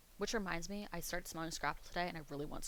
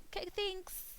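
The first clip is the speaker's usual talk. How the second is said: much higher-pitched polite reply; awkward mock thanks; 12 semitones higher, slower than usual. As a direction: squeak out a put-on thank-you. Acting out her awkward reply.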